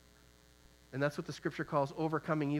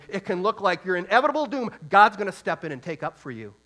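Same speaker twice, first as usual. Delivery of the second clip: louder and higher, driving the promise home; rising preaching shout; 4 semitones higher, 8 dB louder than usual. Real, not acted. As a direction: swell into a full preaching cry on God's gonna step in. Preacher building to a climax.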